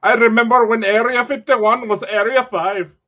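put-on loud, high Indian accent. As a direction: Indian accent old-timer voice. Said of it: "Was that, like, an Indian accent".

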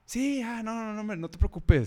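put-on high girly voice, light; imitating the girl. Imitating the recovered girl reassuring him, high-voiced. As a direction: play the girl brushing it off.

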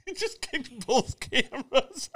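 squeaked out through laughter, high and slow; slow, high-pitched words breaking into laughs; laughing. Real, not acted. Host cracking up as the cameras get kicked, laughing.

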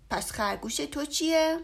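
put-on a boy calling out his friend; boy's retort. A narrator's reading. Boy challenges Bear about his toy rabbit. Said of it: "گفت".

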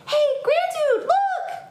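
put-on very high child's shout; alarmed yell. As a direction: squeal a warning to the grown-up. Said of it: "yelled Lucy".

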